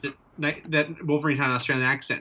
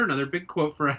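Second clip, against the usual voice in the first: ordinary delivery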